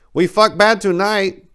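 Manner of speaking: put-on high, slow Vietnamese-accented soldier, cheerfully crude; broken English. A narrator's reading. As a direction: Trang mangling English with a grin, high and slow. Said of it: "Trang's mutilation of the English language".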